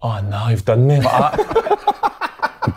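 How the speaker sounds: drawn-out laughing oh no; slow laughing lament. Retold or imitated. Duped guard realising the prank, slow laughing groan. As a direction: groan it slowly through laughter. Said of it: "he's like".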